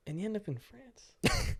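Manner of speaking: soft, slow deflated tone; mock disappointed. Real, not acted. Host deflating over ending up in France, soft and slow. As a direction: let the punchline sag.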